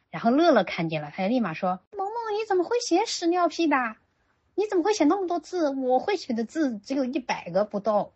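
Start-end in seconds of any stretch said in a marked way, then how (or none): 2.0-8.1 put-on high, eager child's chatter; little girl voice. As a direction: sound like an amazed small girl. Mother voices little girl Lele. (高声模仿惊叹的小女孩)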